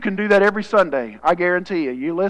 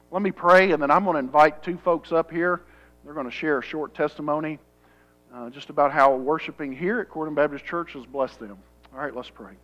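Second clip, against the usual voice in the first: normal talking voice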